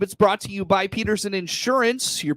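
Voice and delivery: loud upbeat announcer opening; radio host intro. Real, not acted. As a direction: kick off the show with energy.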